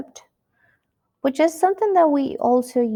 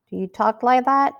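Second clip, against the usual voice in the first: ordinary talk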